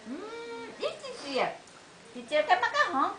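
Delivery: put-on high coaxing baby talk. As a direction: coax the baby sweetly, up high. Coaxing baby to share food.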